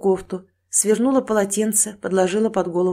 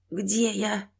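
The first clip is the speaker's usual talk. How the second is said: soft, weak hoarse question; faint rasp on waking; croaking; 6 dB quieter than usual. Acted. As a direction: croak it out, barely conscious. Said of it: "прохрипела она".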